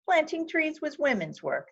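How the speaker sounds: mocking men's sneer, pitched high. Men mocking Wangari's tree planting, high sneering scoff. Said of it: "Some men laughed and sneered".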